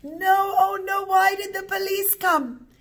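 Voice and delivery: high, loud wailing lament; acted crying. Old mother laments the police arriving, crying out. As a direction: wail it as a storyteller playing distress. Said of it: "kept on crying".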